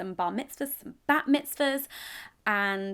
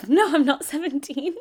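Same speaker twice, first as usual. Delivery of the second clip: laughing; 4 semitones higher, 7 dB louder than usual. Real, not acted. Laughing at mistake.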